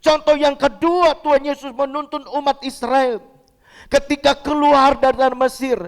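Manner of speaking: high-pitched, insistent pulpit voice; emphatic preaching. Real, not acted. Pastor assuring the congregation of God's presence, emphatic preaching.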